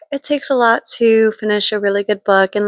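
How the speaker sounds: ordinary delivery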